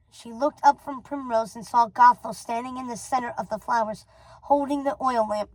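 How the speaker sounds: usual speaking voice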